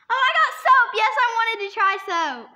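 loud and high, thrilled; excited shout. Real, not acted. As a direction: call out gleeful. Excited at soap bean.

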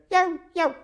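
high yelping dog barks; dog barking. Retold or imitated. Storyteller imitating Fluffy at the seance, high barking. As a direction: yelp sharply like a small dog. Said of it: "He barked exactly like Fluffy".

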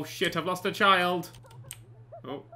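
ordinary delivery

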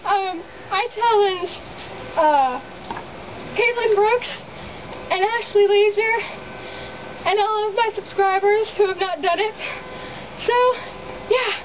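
high-pitched, just soaked in ice water; in ice cold water. Real, not acted. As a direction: talk through the freezing shock, pitched high.

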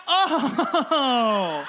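loud, high drawn-out "oh"; mock shock. Real, not acted. Comic reacts to the crowd clapping for off-brand soda, mock shock. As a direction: exclaim it loud and high, breaking into laughter.

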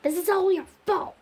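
high, loud blaming outburst; angry accusation. Acted. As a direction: lash out with loud blame. Acted blame in the story.

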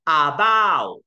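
"about" with a full, loud first "A"; overdone, stretched "about"; wrong pronunciation. Shown as a demonstration. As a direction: hit both syllables of "about" hard. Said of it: "你没有念about".

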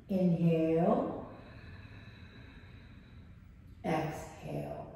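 slow drawn-out yoga breath cues. Acted. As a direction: guide each breath slowly.

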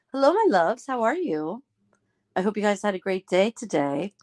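ordinary talk, nothing marked

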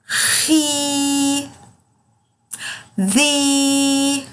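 slow teacher syllable drill; long drawn vowels. Shown as a demonstration. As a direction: drill each long ee for the kids. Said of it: "بنطق صوت الحرف وهو مكسور وبطول".

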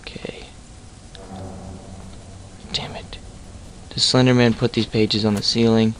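ordinary delivery

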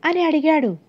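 usual speaking voice

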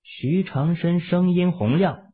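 ordinary delivery, nothing marked

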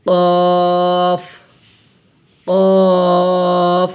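"কফ" with a heavy back-of-mouth k and rounded o; thick sound. Shown as a demonstration. Teacher showing the thick letter, heavy long "কফ". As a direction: hold "কফ" long, thick and full. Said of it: "মোটা".

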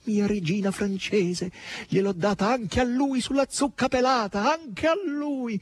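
high, giddy and mocking; gloating with glee, dragging the end; giddy gloating maid voice; gleeful maid. A narrator's reading. Natascia cackling gleefully to mistress.

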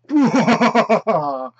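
loud evil cackle; mustache twirling villain. Shown as a demonstration. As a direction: throw back a big villain laugh. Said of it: "that's my villain laugh".

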